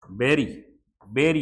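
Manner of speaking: बेरी said as "beri", short e like bet; teacher models बेरी as "beh-ree" for the class; correct pronunciation. Shown as a demonstration. Hindi teacher shows students how to say बेरी. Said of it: "बेरी उच्चारण है इसका".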